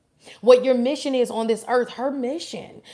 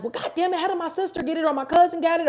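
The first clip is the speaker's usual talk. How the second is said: mock frustrated rant; 7 semitones higher, faster than usual. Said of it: "don't get frustrated when it's like".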